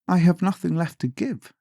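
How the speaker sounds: put-on high, plaintive girl's voice; a helpless girl with nothing left. A narrator's reading. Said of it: "answered the girl".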